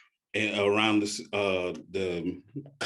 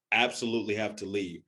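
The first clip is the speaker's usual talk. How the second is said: usual speaking voice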